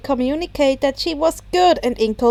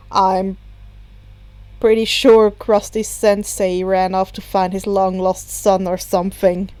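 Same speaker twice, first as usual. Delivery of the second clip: a villain who couldn't care less; bored Dabi; 5 semitones lower than usual. A narrator's reading. Dabi voiced low.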